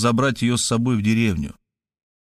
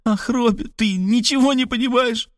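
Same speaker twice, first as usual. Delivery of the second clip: sobbing mumble; 12 semitones higher than usual. A narrator's reading. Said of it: "всхлипывала и по-прежнему бормотала".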